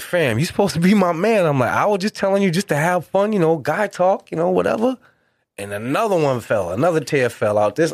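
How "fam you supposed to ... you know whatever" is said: pleading. Retold or imitated. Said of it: "So I'm like".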